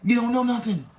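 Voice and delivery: ordinary delivery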